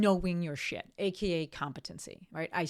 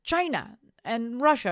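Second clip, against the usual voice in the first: mocking dim reply; 5 semitones higher, 6 dB louder, slower than usual. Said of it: "they're just like".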